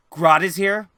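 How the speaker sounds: loud, high, indignant question; upset outburst. Acted. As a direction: play her upset at the news. Clementine upset at hearing of Grodd.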